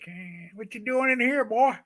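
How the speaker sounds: high-pitched put-on farmer voice; typical farmer. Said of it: "This guy is like the typical farmer".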